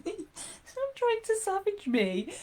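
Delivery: high, slow mock telling-off; playful scolding. Real, not acted. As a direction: tease the dog for nipping.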